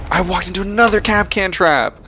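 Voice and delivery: frustrated yell. Real, not acted. Walks into a trap mid-game.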